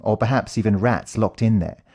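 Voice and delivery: plain reading voice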